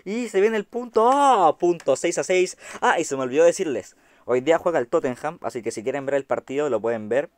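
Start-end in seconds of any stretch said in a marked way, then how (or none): 1.1-1.6 loud, high "ah!" of joy; excited yell. Real, not acted. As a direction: yell as the point lands. Point won.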